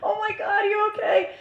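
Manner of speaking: high, mock-weepy concern; crying and laughing. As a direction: wail it in retold sympathy. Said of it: "We're, like, crying, laughing".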